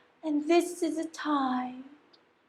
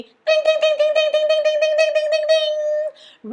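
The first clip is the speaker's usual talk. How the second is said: high, loud ringing dings; steady high ding-ding chant; school bell imitation; 13 semitones higher, 6 dB louder, faster than usual.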